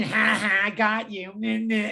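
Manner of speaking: lightly voiced teasing gotcha; a hint of a smug teacher catching you out; mock gloating. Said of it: "And it doesn't mean".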